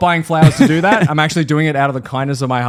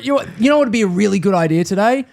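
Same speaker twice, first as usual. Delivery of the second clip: higher, fast, mock-cheerful dad; sly dad pitch; 8 semitones higher, faster than usual. Son mimicking dad's pitch, quick mock-cheery dad voice. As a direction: sell the chore like a treat.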